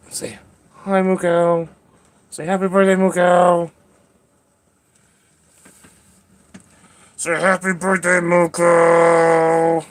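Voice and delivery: high-pitched singsong coaxing; high pet talk. Real, not acted. Getting the cat to say happy birthday, high pet talk. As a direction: prompt a pet in a high, drawn-out voice. Prompting cat to greet someone.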